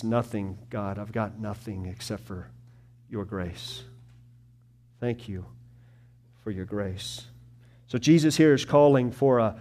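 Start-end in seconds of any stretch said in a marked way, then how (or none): 0.0-7.3 soft, broken prayer of surrender; whisper-soft admission of need; humble prayer. Shown as a demonstration. Preacher voicing a humble prayer, very soft. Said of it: "But it's".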